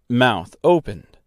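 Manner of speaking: ordinary delivery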